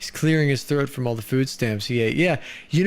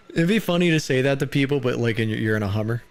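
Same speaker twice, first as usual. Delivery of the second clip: ordinary delivery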